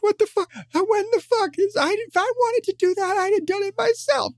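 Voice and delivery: sky-high hysterical mock tantrum; Joker meltdown. Fan acts out Joker after Batman's unmasking, high-pitched meltdown. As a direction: rant like a cartoon villain coming unglued. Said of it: "has this total fucking meltdown".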